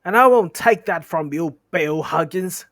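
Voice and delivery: loud, high furious retort; a troll ready to punch; angry troll. Acted. William retorts angrily.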